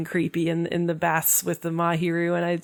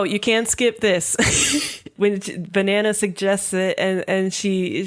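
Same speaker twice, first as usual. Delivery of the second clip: usual speaking voice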